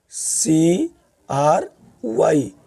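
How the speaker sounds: letters spelt out slowly; teacher spelling a word slowly. Shown as a demonstration. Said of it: "जैसे आप देख लीजिए".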